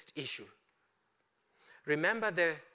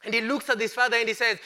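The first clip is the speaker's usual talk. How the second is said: fast; 5 semitones higher, 9 dB louder, faster than usual. Acted. Narrating the older brother.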